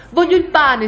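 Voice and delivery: high, loud demand; childish shriek. A narrator's reading. Little Peter suddenly demands the bread, shrieking. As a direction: squeal it out suddenly. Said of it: "strillò a un tratto Peter".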